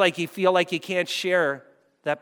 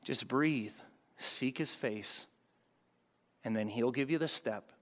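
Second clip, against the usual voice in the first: soft calming; 6 semitones lower, 11 dB quieter than usual. Shown as a demonstration. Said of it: "calming listeners".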